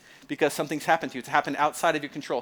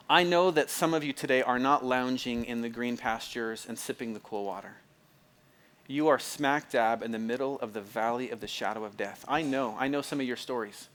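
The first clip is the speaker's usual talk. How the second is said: ordinary delivery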